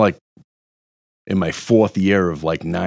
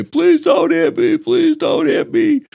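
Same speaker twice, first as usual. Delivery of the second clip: high, drawn-out cowering plea; mocking high-pitched beg for mercy; mock begging; 3 dB louder, slower than usual. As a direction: squeal it like someone cowering from a beating. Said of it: "covering up and say".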